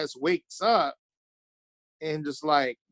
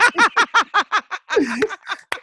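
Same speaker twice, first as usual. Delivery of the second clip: loud, high burst of laughter; real laugh; 13 semitones higher, 5 dB louder than usual. Real, not acted. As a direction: crack up at your own story. Cracking up at own story.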